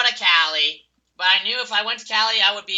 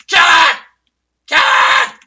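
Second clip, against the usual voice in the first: high loud screamed command; frantic shout; 11 semitones higher, 13 dB louder, slower than usual. Real, not acted. Gamer yelling at team to kill him, high shout. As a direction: yell it in the heat of battle.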